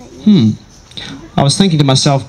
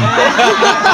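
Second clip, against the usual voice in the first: laugh; 7 semitones higher than usual. Real, not acted. Laughs at own story.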